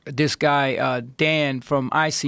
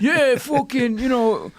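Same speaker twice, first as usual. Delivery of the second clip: high mocking meathead quote; 9 semitones higher, slower than usual. Mocking the meathead at the gym, high mock bro voice. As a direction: mimic the trainer as a dumb bro. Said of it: "and then he's like".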